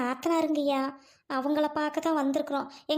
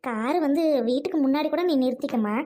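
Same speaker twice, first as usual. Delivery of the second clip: ordinary delivery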